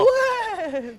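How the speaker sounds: possessed. Acted. Exorcism, demon answers.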